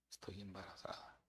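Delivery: hushed, breathy confession; retold whisper of a frightened girl. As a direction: whisper it like a scared secret.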